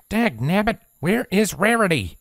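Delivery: high voice, restless and groaning; fed-up country mare calling out; Applejack, restless groan. A narrator's reading. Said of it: "Applejack groaned restlessly".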